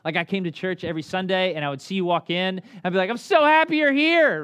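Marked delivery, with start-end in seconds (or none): 3.1-4.4 much higher and louder, put-on brightness; fake cheerful. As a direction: sell a cheerful front you don't feel. Faking cheer at church.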